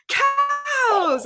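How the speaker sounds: high, loud called-out cue; calling out. Retold or imitated. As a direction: call the next animal to the kids. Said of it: "And then she'd be like".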